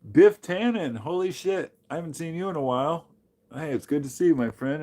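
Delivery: high, loud happy shout-out; excited greeting. Real, not acted. Greeting a returning viewer.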